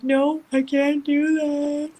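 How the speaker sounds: desperate refusal. Retold or imitated. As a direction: drag it out, begging through the pain. Refusing husband during labor pain.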